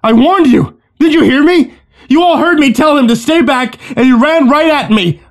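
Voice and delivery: high, loud frantic accusation; voice up an octave. A narrator's reading. Owen blames the man he shot, frantic high voice. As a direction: let panic push the voice way up. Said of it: "His voice ticked up an octave".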